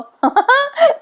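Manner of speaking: quick rising giggle; high laugh. Real, not acted. Narrator amused by the strange looks, high giggle.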